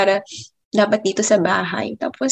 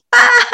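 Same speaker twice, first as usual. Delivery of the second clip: excited scream; 13 semitones higher, 7 dB louder than usual. Retold or imitated. Telling mom the news.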